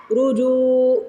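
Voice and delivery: رجو with a heavy ra and a long jeem. Shown as a demonstration. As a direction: say رجو slowly, full ra, stretched جو. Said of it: "جیم کو کھینچنا ہے". (رجو میں را موٹی اور جیم کھینچ کر)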